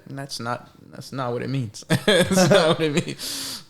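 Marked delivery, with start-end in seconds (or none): 1.9-3.6 laughing, higher and louder. Real, not acted. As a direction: laugh through it. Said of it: "laughs while repeating".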